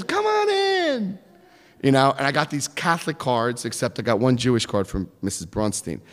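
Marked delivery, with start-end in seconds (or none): 0.0-1.2 put-on high, slow old woman; high slow old woman inviting in; old lady voice. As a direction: drawl it like a sweet old neighbor.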